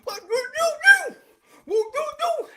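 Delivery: high hooting with consonants lost; mock wordless-sounding protest; imitated deaf arguer.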